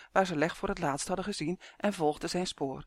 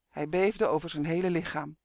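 ordinary delivery

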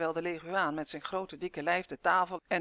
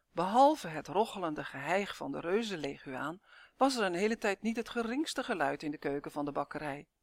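ordinary delivery, nothing marked

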